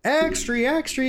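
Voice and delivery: high bright mock paper-boy cry; newsboy call. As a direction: shout it like a street newsboy. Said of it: "hot off the rumor presses".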